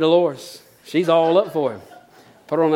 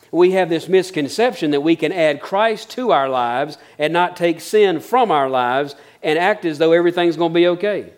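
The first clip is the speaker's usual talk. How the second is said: normal talking voice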